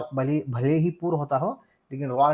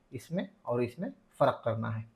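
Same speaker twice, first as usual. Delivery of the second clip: ordinary talk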